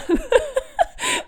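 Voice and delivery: short high laugh. Real, not acted. Laughing at own anxiety.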